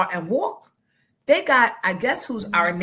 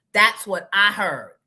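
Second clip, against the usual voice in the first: ordinary talk, nothing marked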